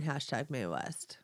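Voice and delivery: low, soft whispered aside; slow quiet whisper. Real, not acted. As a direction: breathe it like a secret.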